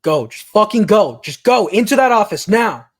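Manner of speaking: loud, high panicked call to teammates; urgent shouted order. Acted. Game master voices a guard ordering the team to go, shouting. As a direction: bellow it as an urgent command. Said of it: "hear one of them call out".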